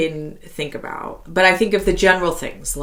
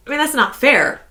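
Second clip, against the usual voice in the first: mock protest; 8 semitones higher than usual. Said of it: "And I was like".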